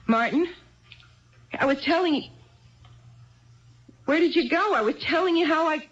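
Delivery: confused, then anxious as he's gone; rising alarm. Acted. Wife realizes husband walked out.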